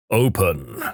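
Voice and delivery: deep mock boom without real volume. Voice from above orders the chest opened, low booming. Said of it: "The booming voice immediately yells".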